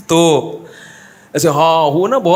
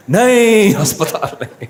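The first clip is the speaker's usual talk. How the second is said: mock panicked refusal; 5 semitones higher, slower than usual. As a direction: yelp the no, then laugh it off. Man refusing hospital, laughing teller. (घबराया हुआ इनकार, हँसते हुए)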